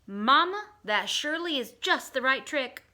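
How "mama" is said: ordinary delivery, nothing marked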